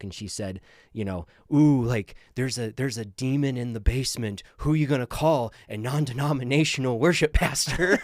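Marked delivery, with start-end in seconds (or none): 1.5-8.0 mock spooky joke voice. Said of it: "there was a comedian that was saying, who did a joke".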